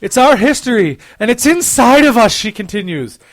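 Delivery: loud, overblown romance reading; romance novel narrator. Pretend. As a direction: declaim like a bodice-ripper. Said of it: "my romance novel voice".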